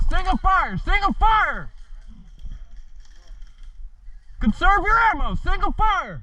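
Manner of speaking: urgent order. Real, not acted.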